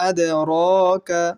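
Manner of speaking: "আদরাকা" slowly intoned with a bounce on the দ; qalqalah. Shown as a demonstration. Teacher showing qalqalah on dal, "আদরাকা" bounced on the দ. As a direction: echo the দ in "আদরাকা", then stretch the middle. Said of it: "দাল সাকিন কলকলা করব".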